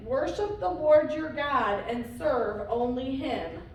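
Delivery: usual speaking voice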